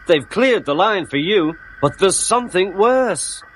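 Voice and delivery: high, worried character voice; mock alarm. A narrator's reading. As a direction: break bad news with a touch of dread. Toby acts out alarming news.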